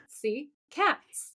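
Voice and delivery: delighted. Retold or imitated. Retold own delighted remark.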